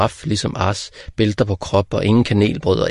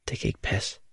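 unvoiced, disbelieving whisper; 10 dB quieter than usual. A narrator's reading. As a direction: breathe it in shock. Ars's shocked thought. (hvisket vantro)

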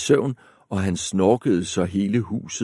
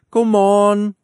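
high loud call, much louder; 12 semitones higher, 8 dB louder than usual. A narrator's reading. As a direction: call into the house. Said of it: "råbte hun".

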